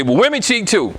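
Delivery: high mock-objecting voice; quoting a skeptic. Speaker to audience, voicing the common objection, mock skeptical. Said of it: "someone always say".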